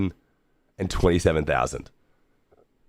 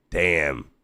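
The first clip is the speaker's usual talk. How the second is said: ordinary talk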